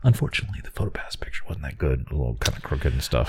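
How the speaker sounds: whispered confession. Real, not acted. Admitting to his cohost the photo flopped, whispered. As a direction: whisper it like a guilty secret.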